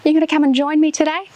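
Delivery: loud, fast, cheerful welcome; upbeat kids' host. Acted. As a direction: pump the kids up for yoga. Upbeat welcome before the session.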